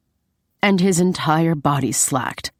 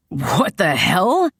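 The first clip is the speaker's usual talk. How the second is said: grumbling; slower than usual. A narrator's reading. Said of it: "he grumbled".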